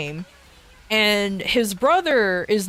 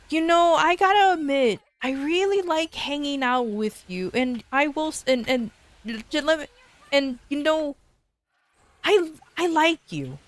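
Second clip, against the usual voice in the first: put-on halting, higher girl's confession; shy confession; 5 semitones higher than usual. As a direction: stammer it out shyly, a bit higher. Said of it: "that's what she said".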